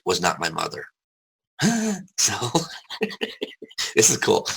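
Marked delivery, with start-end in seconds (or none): 1.6-2.1 a short high burst of laughter; real laugh. Real, not acted. Guest laughing after revealing his mother was not his mother, real laugh. 2.4-3.9 soft laugh. Real, not acted. Laughs again before "this is cool.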